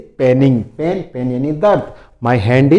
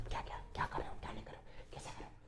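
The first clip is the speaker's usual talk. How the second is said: faint breathy whisper, far softer; 4 semitones lower, 28 dB quieter, much faster than usual. Shown as a demonstration. Teacher demonstrates a whisper to the students. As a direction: whisper the questions almost silently. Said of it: "फुसफुसाना क्या होता है?".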